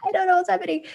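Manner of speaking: high-pitched mock panic; retold anxious confusion. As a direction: act lost and flustered. Retelling her own anxious state.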